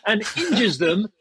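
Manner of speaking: high, drawn-out imitation of a denouncer; mock outraged accusation. Field owner denouncing the player on livestream, mock outraged.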